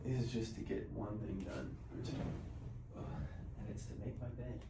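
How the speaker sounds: hushed, soft-spoken morning talk; quiet. Real, not acted. I gotta be a little quiet 'cause Sean is sleeping.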